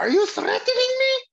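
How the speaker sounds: high, loud manic cartoon yell; Cornholio impression. Prankster screaming on the Sears escalator, Cornholio screech. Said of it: "I scream this on the Sears escalator".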